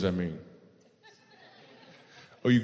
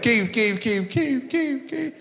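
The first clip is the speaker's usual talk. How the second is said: each Keith climbs higher; mock rising name call; 10 semitones higher, much slower than usual. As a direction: copy him repeating Keith, going up in pitch every time. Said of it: "You went".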